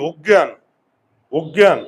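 অজ্ঞান said loud as "oggya", rounded o; wrong pronunciation. Shown as a demonstration. Teacher to pupils, loud wrong o-reading. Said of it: "আমরা তো অজ্ঞান বলি না".

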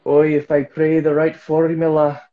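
loud, high, mock Scottish recital; hammed-up Scottish accent; accidental Scottish accent. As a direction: drone it out like a prayer in a put-on Scottish lilt. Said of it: "I just became a Scottish person".

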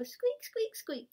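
playful mouse squeaks; squeaky mouse. Retold or imitated. Imitating mouse in picture book.

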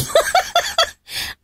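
high airy giggle; breathy high giggle. Real, not acted. Mom giggling after the slip. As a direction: giggle at yourself.

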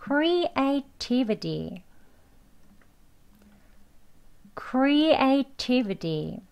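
"creativity" with the last t softened to a d, slowly; slow "creativity" with a d-like final t; flapped t. Shown as a demonstration. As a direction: say "creativity" ending "-vidi", drawn out. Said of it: "D로 끝납니다".

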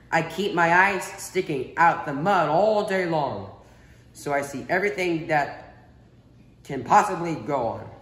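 a hint of British crawfish; British accent. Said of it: "Do the British accent".

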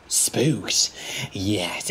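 whispered, sly dawning idea; scheming whisper. Acted. Diesel realising a way to scare them, whispering. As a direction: hiss it as the plan hits you.

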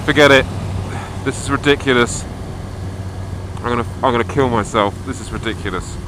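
real exasperated yelling; frustrated shout. Real, not acted. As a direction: shout it out, fed up with the snow. Riding blind in snow, frustrated.

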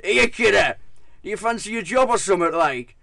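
loud, high Teesside call; Teesside accent. Retold or imitated. Dog CEO calling the narrator from behind, loud Teesside accent. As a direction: bellow it like a cheeky Teesside boss.

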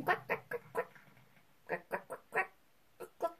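tiny, soft quacks trailing to whispers; duck-like chicken impression. Hider trying to act like a chicken, soft duck quacks. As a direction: quack softly like a badly faked chicken. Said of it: "I sound like a duck".

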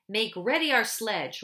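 loud, commanding order. A narrator's reading. Witch orders the dwarf, loud and commanding. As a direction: bark it at the servant. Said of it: "ordered the witch".

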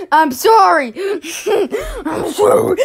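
mock sorry, breaking into laughs; laughing apology. Real, not acted. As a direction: say sorry but can't stop laughing. Fake apology through laughter.

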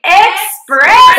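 loud, high Southern American group shout; hammed-up Southern American cheer; Southern American accent. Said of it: "in your most Southern American accent".

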